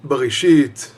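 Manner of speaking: ordinary talk, nothing marked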